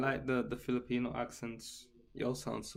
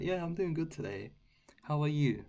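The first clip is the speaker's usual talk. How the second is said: polite, higher-pitched livestream greeting; code-switch into a polished professional register; 5 semitones higher than usual. Shown as a demonstration. On live streams in professional settings, polite higher voice. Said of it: "just professional settings".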